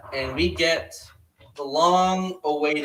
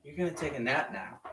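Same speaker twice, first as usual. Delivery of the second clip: soft, softer command; 9 dB quieter, faster than usual. Retold or imitated. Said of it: "So Grogu says".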